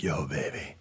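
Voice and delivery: low, soft come-on; mock seduction. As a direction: murmur it low like a hammy seducer.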